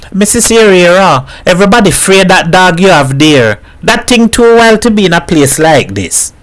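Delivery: a mother mocking her dead husband to a visitor; lightly voiced Trinidadian mother joking.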